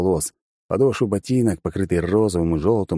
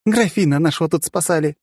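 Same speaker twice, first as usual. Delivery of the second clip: irritated retort; 10 semitones higher than usual. Acted. Girl answering the drone irritably.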